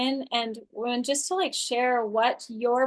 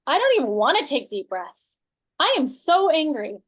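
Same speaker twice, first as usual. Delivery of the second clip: loud, petulant mock tantrum; acted angry refusal; angry protest; 3 semitones higher, 5 dB louder than usual. Shown as a demonstration. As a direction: protest loudly with swooping pitch. Acts the angry inner voice.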